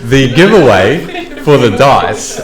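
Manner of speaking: talking through a laugh. Real, not acted. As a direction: carry on the announcement, still laughing. Laughing while resuming the announcement.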